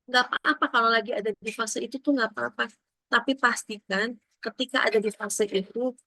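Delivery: ordinary talk, nothing marked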